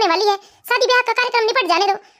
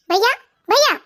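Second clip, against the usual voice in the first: calling out for brother. Acted.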